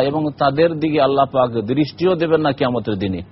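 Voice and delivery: ordinary talk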